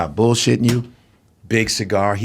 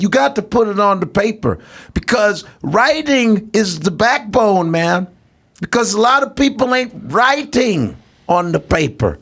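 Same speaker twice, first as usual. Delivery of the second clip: Cosby impression, much higher, laughing; Bill Cosby impression; 7 semitones higher than usual. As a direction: stretch it out like Cosby lecturing. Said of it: "He's like".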